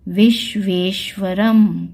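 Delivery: विश्वेश्वरम spelled out slowly, syllable by syllable; slow syllable reading. Shown as a demonstration. Teacher reading a Sanskrit word to students, slow and careful. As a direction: read विश्वेश्वरम slowly, each syllable clear. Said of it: "दूसरा शब्द है".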